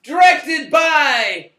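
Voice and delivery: loud, high movie-trailer announcer; announcer shout. Acted. Host announcing the movie, trailer announcer shout. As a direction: belt out the title like a trailer voice.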